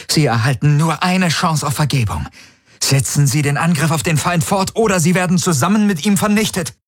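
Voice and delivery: high, urgent, commanding threat; angry ultimatum. A narrator's reading. Ylana's transmission to her fleet, angry ultimatum. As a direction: threaten them in a high voice, rushing the ending. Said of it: "Sie hatten Ylanas Übertragung gehört".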